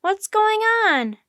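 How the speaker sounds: high, loud, stretched-out shout; loud high-pitched question; yelling. A narrator's reading. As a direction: yell it slowly over a noisy crowd. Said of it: "Annie yelled".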